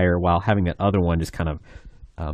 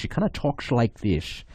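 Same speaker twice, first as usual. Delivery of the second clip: lightly imitated Scottish accent with pursed lips, a bit higher; a quick Sean Connery Scottish impression, lips pursed; 4 semitones higher, 4 dB quieter than usual. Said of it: "that really interesting Scottish accent".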